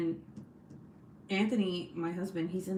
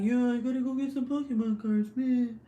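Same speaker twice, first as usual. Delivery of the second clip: louder, higher husband impression; mock husband voice; 5 semitones higher, 5 dB louder than usual. Husband wanting Pokemon cards, louder mock husband voice. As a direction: ham up the silly husband voiceover. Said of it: "why his voiceover is like that".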